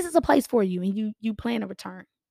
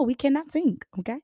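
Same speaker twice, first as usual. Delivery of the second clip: ordinary talk, nothing marked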